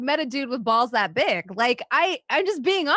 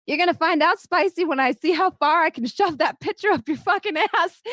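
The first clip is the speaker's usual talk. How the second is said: higher, louder mock threat; a tiny friend squaring up; fierce threat; 6 semitones higher, 3 dB louder than usual. Small friend threatening a pushy guy at the bar, a louder mock threat. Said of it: "she's like".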